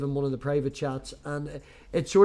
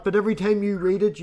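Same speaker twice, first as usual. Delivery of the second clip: ordinary talk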